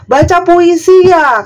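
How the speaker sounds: high, slow mock complaint; mocking netizen. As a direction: drag it out like a snide commenter. (meniru komentar nyinyir, tinggi dan pelan)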